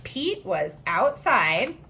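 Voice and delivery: "Pete was outside" read slow; slow reread. Shown as a demonstration. Teacher rereads "Pete was outside" slowly for the class. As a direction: drag out the phrase. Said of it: "I have when".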